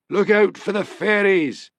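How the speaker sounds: slow, high cackling taunt. A narrator's reading. Seer taunting the departing policeman, cackling. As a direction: cackle it out like a gleeful old seer. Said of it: "cackled Angus".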